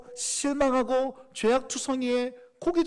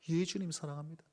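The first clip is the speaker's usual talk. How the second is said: much softer, subdued reply; ashamed hushed answer; 9 semitones lower, 11 dB quieter than usual. Acted. Peter answering Jesus in a quiet, ashamed voice. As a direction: confess love barely above a murmur.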